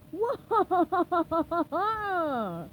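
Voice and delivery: slow evil villain cackle; maniacal laugh. Acted. Plankton gloating over his scheme, slow maniacal cackle. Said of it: "He laughed ma-, maniacally".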